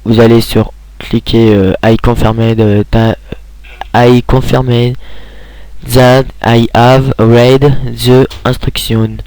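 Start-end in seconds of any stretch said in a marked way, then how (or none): 1.8-2.6 halting English with a thick French accent. Real, not acted. Tutorial maker reading a button label, French-accented English. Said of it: "mon accent est très pourri, mon accent anglais". 3.9-9.2 slow, laboured English with a heavy French accent; bad English accent. Real, not acted. Tutorial maker reading out a checkbox, bad French-accented English. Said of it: "mon accent est très pourri, mon accent anglais".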